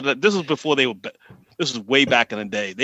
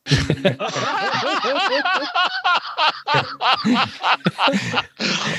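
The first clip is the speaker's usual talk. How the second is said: big high laugh, burst out; long high laugh; 12 semitones higher than usual. Real, not acted. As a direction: laugh hard in surprise. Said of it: "your accent is ridiculous".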